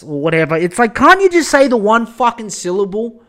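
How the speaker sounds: normal talking voice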